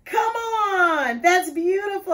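a real happy yell at the gift; delighted shout. Real, not acted. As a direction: let the joy burst out.

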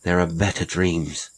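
ordinary delivery, nothing marked

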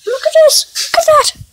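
squeaky cat voice, loud and high; high squeaky kitty voice. Pretend. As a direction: voice a tiny excited kitten. Playing a kitty character.

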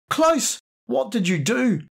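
woman bursting in on a killing, horrified; shocked cry. Acted. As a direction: scream his name in shock. Tasso bursts in after shooting.

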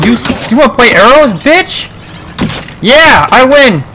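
triumphant taunt. Real, not acted. Winning arrow fight.

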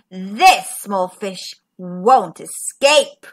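slow, low villain gloat; menacing shark. A narrator's reading. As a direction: savour the coming catch. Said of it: "thought the shark".

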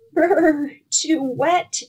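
high little squeak. A narrator's reading. Gingerbread man with water at his feet, high squeak. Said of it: "squeaked the gingerbread man".

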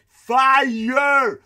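high, loud yell of fire; imitated shout. Comedian mimicking a moviegoer shouting during a sad scene, loud yell. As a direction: bellow it like the man in the dark cinema. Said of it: "imitating the moviegoer".

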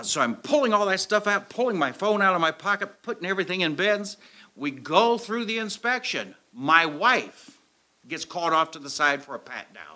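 usual speaking voice

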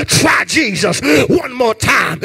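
ordinary delivery

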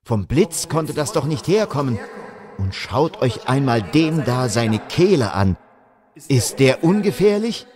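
frightened soldier. Acted. Frightened soldier urging comrades.

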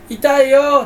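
high, loud, deadpan "ouch"; a monotone wail of pain; flat fake cry of pain. Showing the stock voice that comes out when hurt, a flat wail. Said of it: "っていうギャグ".

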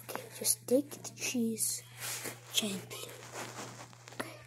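very soft, careful murmuring; stealthy whisper. Acted. Mouse easing the cheese from the trap, whispering. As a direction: breathe it low so nothing springs.

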